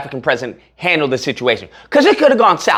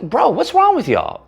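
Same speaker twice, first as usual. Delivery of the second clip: usual speaking voice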